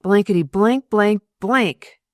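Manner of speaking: loud, grumbled mock curses; grumbling curse. A narrator's reading. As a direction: spit out the blanks loudly. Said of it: "he announced in due time".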